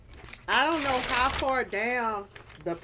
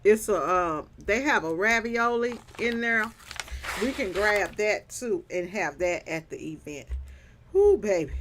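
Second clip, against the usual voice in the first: ordinary delivery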